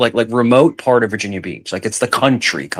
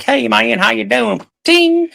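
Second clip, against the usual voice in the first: high, twangy Virginia country greeting, pitched up; mock country accent; 12 semitones higher, slower than usual. Mocking how country folks greet people, in a mock country accent. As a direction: put on a hammed-up southern drawl. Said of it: "never spoke country".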